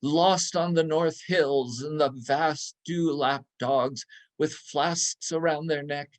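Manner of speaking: ordinary delivery, nothing marked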